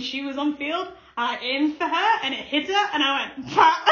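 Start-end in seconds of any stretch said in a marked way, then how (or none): 3.5-3.9 triumphant cry. Retold or imitated. Hitting the bully with the ball.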